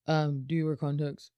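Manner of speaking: bored clerk. Retold or imitated. Imitating the bored DMV guy.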